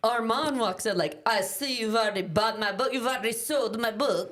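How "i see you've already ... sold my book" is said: put-on French accent. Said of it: "I don't know how to do a French accent".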